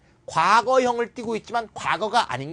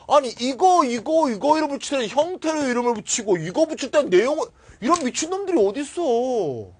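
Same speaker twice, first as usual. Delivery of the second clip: furious rant; 7 semitones higher, 3 dB louder than usual. Real, not acted. Teacher ranting at textbook authors.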